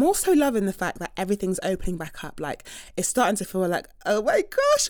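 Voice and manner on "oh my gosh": high squeal of relief, much higher; excited gasp. Retold or imitated. Host imitating everyone's relief at lockdown ending, high excited squeal. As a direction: burst out with giddy joy.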